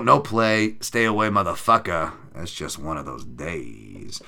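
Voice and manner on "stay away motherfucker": ordinary talk, nothing marked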